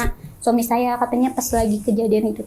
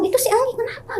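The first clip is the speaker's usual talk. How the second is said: much higher, startled ask; alarmed question; 12 semitones higher, faster than usual. Retold or imitated. Father-in-law startled by his possessed daughter-in-law, higher alarmed ask. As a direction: pitch it up like a shocked old man.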